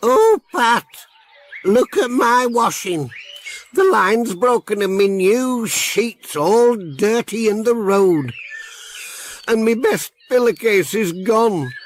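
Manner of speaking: a fretting granny over her washing; Granny Dryden. Acted. Granny Dryden shows Pat her washing, high distressed voice.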